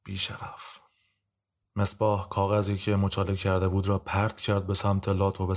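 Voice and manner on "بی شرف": whispered insult, barely voiced; under the breath. A narrator's reading. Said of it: "ناهید زیر لب گفت".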